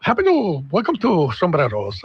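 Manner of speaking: hammed-up waiter's would-be Irish accent; put-on Irish accent. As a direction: greet diners in a shaky-authentic mock Irish accent. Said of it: "he puts on this Irish accent".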